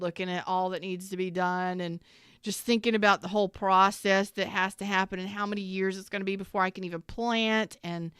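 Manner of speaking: normal talking voice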